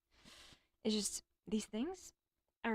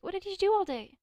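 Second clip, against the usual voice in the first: a hint of a nagging mom, higher and louder; mock mom; 9 semitones higher, 6 dB louder than usual. Daughter mimics mom asking about her day, lightly. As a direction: toss off a light impression of mom. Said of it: "she's gonna be like".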